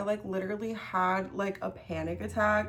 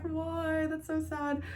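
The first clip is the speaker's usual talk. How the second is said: mock sad; 6 semitones higher, slower than usual. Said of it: "And I was like".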